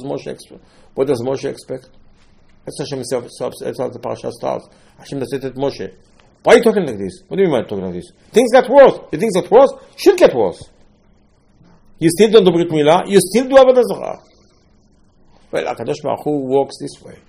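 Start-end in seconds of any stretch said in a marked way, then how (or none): 6.4-14.2 louder and higher, a scolding divine rebuke; angry rebuke. Acted. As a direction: thunder it down as God scolding Moshe. God rebukes Moshe, acted quote.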